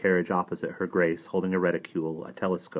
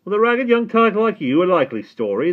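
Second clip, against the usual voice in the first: high, loud scornful sneer; mock the ragged boy; sneering gatekeeper; 12 dB louder than usual. A narrator's reading. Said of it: "the gatekeeper sneered".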